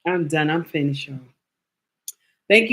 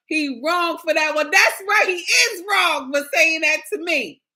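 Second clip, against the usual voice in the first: high, loud playful outrage; excited shout; 9 semitones higher, 4 dB louder than usual. Real, not acted. Shouting back at the teasing viewer, high and loud.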